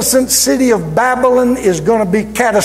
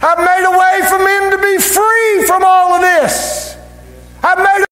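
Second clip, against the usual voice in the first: high, sustained preacher's cry; ringing shout of victory; impassioned proclamation; 10 semitones higher than usual. Acted. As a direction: cry it out to everyone, pitched high. Preacher acts Christ crying out.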